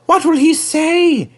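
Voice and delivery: very high, slow worry; worried. A narrator's reading. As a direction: fret anxiously to yourself. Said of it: "he asked himself sadly".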